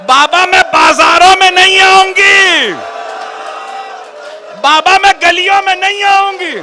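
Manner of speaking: loud, high, wailing preacher's lament; rising grief-stricken sermon, loud and slow; majlis recitation at full force. Acted. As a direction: cry the lament out to the mourners.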